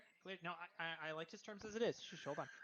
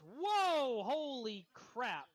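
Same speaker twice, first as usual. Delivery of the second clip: mock shock, louder and drawn out; surprised; 3 semitones higher, 5 dB louder, much slower than usual. Blacksmith stunned by the wife's offer, hammed-up surprise. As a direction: gasp at the offer, slowly. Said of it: "He goes".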